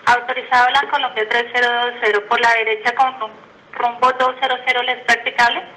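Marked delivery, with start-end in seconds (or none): none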